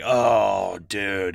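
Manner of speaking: loud, stunned "oh, dude"; shocked exclamation. Retold or imitated. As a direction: blurt it out like you just saw the monster. Reacting to the monster reveal.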